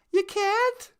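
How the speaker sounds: ordinary talk, nothing marked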